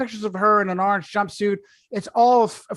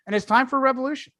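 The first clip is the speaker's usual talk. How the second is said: ordinary talk, nothing marked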